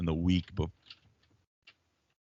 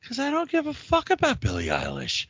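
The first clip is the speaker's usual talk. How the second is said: much higher put-on voice; retold reply in a high mock voice; mock exasperated retort. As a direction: squeak out the retort. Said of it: "And I'm like".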